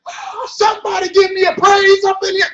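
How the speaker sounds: high, loud call for praise; preacher calling for praise; hollering preacher. Retold or imitated. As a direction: holler it from the pulpit.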